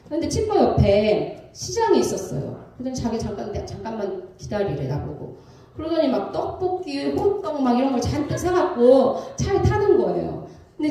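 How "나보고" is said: ordinary delivery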